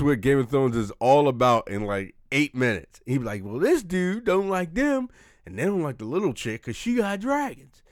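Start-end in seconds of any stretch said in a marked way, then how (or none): 3.4-7.8 higher voice, stretching out the explanation; Samuel L. Jackson. Acted. Explaining Game of Thrones to friends as Samuel L., raised impression. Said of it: "You know how he talks".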